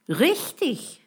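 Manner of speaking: high, bright-pitched exclamation; calling out agreement. A narrator's reading. Friends agree with the boy about their missing pal, calling out. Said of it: "riefen die anderen".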